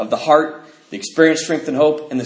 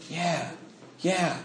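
much softer, murmured yeahs; soft nodding agreement; 12 dB quieter than usual. Retold or imitated. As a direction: mimic listeners quietly agreeing. Imitating guys nodding along.

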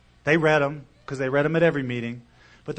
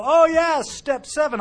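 mock old-timers; 12 semitones higher, 7 dB louder, slower than usual. Mocking the regulars at meeting.

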